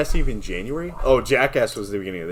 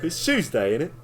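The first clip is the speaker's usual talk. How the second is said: British accent impression; 5 semitones higher than usual. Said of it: "whip out a British accent".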